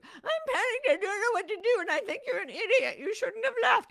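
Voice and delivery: high, loud, anxious self-doubt voice; panicky self-doubt. Pretend. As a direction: squeal it out as your panicking doubts. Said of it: "self-doubt speaking".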